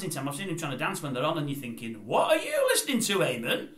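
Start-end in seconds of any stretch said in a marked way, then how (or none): 2.1-3.7 mock disbelief. Mock question to dancing Eamon.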